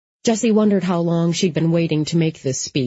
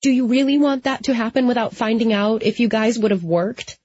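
put-on higher-pitched, insistent young friend; Molly, pressing him; 6 semitones higher than usual. A narrator's reading. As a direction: press the question in a higher character voice.

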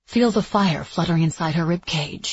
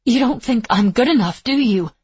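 raised, higher accusing voice; hurt challenge; 6 semitones higher, 6 dB louder than usual. A narrator's reading. Etta challenges her teacher's faith in her, hurt and loud. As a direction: throw it at them as a wounded accusation.

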